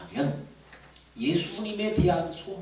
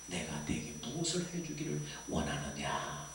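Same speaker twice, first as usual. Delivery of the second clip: very low, soft question; hushed, solemn voice of authority; Jesus; 12 semitones lower, 8 dB quieter than usual. Retold or imitated. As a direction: ask gently in a deep, quiet voice. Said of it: "예수님이".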